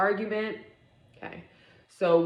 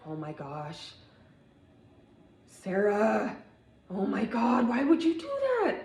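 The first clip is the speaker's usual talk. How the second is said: shocked coworker; 5 semitones higher than usual. Retold or imitated. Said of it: "her coworker is".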